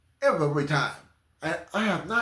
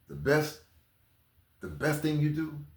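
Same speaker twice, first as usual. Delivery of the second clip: ordinary talk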